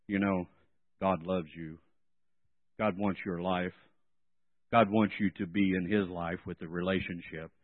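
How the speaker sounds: low droning monotone, no life; monotone preaching. Shown as a demonstration. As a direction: preach like a bored teacher.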